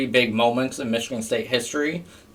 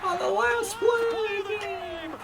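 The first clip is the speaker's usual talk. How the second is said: high excited broadcaster call; Sean McDonough voice. Shown as a demonstration. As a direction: shout the play-by-play way up high. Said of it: "bring out my Sean McDonough high school girl voice".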